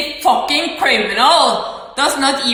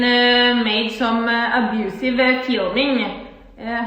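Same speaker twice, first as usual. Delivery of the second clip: usual speaking voice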